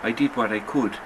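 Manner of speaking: ordinary delivery, nothing marked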